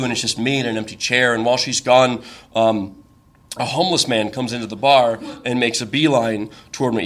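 normal talking voice

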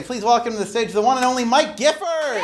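high, rising announcer call; host bringing the next storyteller on, announcer style; announcer introduction. Real, not acted. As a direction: announce him to the stage.

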